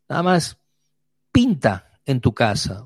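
normal talking voice

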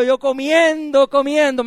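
high, loud, slow chewing words; hammed-up slow eating; slow comic repetition. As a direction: stretch out the eating. Comic story of overeating.

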